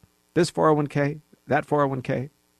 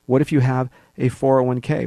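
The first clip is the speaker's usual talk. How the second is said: normal talking voice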